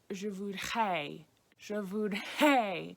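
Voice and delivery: "Je voudrais" with the R softened almost to an H, slow; soft H sound. Shown as a demonstration. Teacher showing learners the softened R, slow and careful. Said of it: "almost make an H sound".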